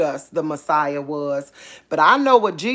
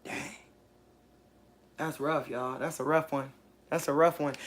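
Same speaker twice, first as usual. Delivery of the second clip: stunned hush; 5 semitones lower, 11 dB quieter than usual. Real, not acted. Stunned by the date result.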